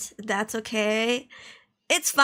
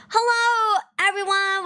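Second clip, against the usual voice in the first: excited; 8 semitones higher, 4 dB louder than usual. Acted. Stream opening greeting.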